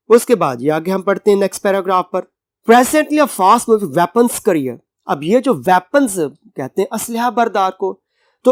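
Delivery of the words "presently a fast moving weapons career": reading the English line slowly and clearly; slow text reading. Shown as a demonstration. Teacher reading the passage aloud to the class, slow and clear. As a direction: spell out the passage for students.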